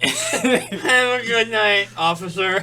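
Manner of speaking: put-on slow, high, laughing drunk goodbye. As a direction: ham up a sloppy drunk farewell. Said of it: "they could probably tell you were drunk".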